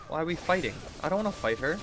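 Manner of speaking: normal talking voice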